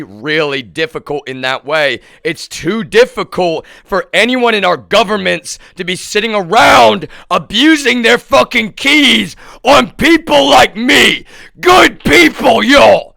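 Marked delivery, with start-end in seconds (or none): 2.2-13.1 outraged rant climbing to a scream; building angry shout. Real, not acted. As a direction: build into a furious cry.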